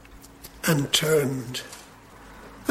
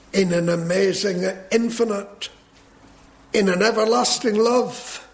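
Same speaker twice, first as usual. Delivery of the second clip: higher-pitched, swelling pulpit proclamation; fervent preaching; 6 semitones higher than usual. Real, not acted. Preacher proclaiming God's everlasting love to his hearers, fervently preached. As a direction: preach it with building fervour.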